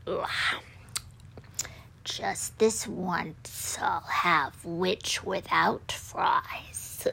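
slow, whispered dragon menace; menacing dragon. A narrator's reading. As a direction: whisper it slowly, savouring the prey. Dragon gloats over cornered witch.

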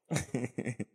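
soft, low laugh; low chuckle. Real, not acted. Pastor amused that he'd never finish, low chuckle. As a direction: chuckle quietly to yourself. Preacher laughs at his remark.

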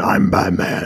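low, dark and brooding superhero voice; Batman. Retold or imitated. Mocking how films made the hero dark, brooding low voice. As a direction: drop deep into a brooding growl impression. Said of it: "they have the voice like this".

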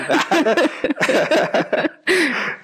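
shrill burst of laughter; high squealing laugh. Real, not acted. Laughing at the chamán's egg.

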